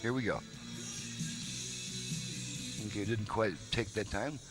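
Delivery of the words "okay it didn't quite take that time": usual speaking voice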